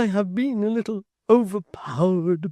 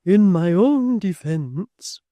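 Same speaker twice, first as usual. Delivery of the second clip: faltering; 6 semitones higher, 12 dB louder, much slower than usual. A narrator's reading. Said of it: "faltered Miss Tox".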